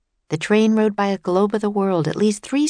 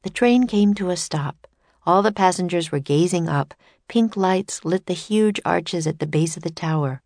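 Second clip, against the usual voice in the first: ordinary delivery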